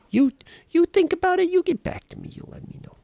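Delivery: tiny high voice tossing the choice back to chat; squeaky voice. Acted. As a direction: chirp it out like a cartoon mouse. Jokey squeaky aside to chat.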